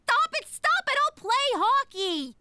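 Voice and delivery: high desperate pleading; pleading shout. Acted. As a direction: beg them to stop. Pleading to stop teasing.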